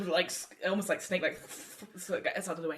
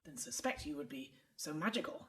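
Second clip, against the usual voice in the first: softer, half-whispered remark; half elf, hushed; 8 dB quieter than usual. Acted. Half elf quietly telling the dwarf about Quin Quin, hushed. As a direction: lean in and confide it quietly.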